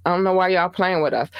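normal talking voice

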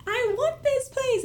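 very high, drawn-out mock plea; pleading whine. Retelling her plea to the realtor, whiny begging. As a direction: whine it slowly like a begging child.

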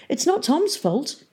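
slow, firm defence; firm defiant. A narrator's reading. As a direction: stand up for Tom. Hester defending Tom.

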